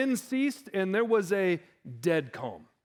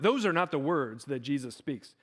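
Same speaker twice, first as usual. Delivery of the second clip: usual speaking voice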